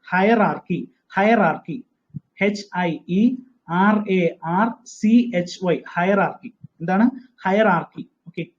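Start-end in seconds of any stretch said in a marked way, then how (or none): 2.4-5.8 each letter said slowly and separately; teacher spelling out the word slowly. Shown as a demonstration. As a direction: dictate letter by letter. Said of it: "hierarchy എന്ന പദം".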